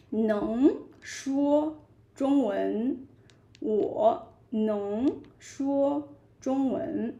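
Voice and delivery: "能说中文" spelled out very slowly; slow model sentence. Shown as a demonstration. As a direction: say it slowly for learners to copy. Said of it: "You can say".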